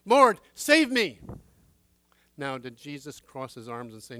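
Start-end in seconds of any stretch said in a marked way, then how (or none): 0.0-1.2 a loud, slow, desperate cry, much louder and higher; cry for help. Acted. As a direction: cry out as if sinking. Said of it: "Peter cried out".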